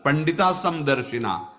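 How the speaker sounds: normal talking voice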